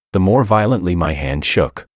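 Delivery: plain reading voice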